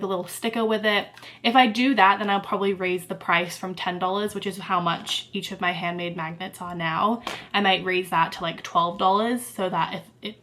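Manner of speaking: normal talking voice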